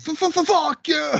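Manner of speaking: high, loud stammering curse; stuttered yell. Retold or imitated. Mimics the video package shout.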